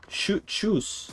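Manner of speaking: tschüss chirped high with a German ü. Shown as a demonstration. Streamer trying German farewells for chat, high chirpy tschüss. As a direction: pop out a bright tschüss. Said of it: "the Swiss way to say it".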